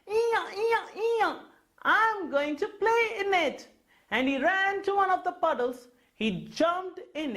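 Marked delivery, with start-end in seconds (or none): 0.1-3.7 high braying donkey voice. Acted. Donkey eager to splash in puddles, braying high. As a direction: bray it out like a playful donkey. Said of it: "He saw the puddles and thought".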